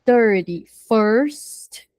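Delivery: "thirty-first" drawn out very slowly; careful, slowed teaching of "thirty-first"; slow model. Shown as a demonstration. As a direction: stretch "thirty-first" out for the class. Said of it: "Então, the".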